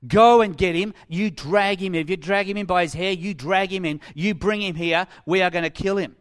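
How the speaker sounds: loud, angry commands to the guards; acted fury, each command punched; angry orders. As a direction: order them out like an enraged leader. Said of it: "They're angry".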